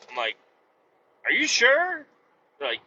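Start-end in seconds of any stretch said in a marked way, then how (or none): 1.2-2.1 high disbelieving question; incredulous. Retold or imitated. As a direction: ask it in disbelief.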